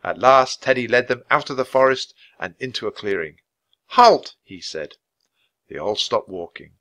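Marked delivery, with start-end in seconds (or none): 3.9-4.4 high, loud command to stop; commanding call. A narrator's reading. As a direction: call a sudden halt to your group. Leader halts the group.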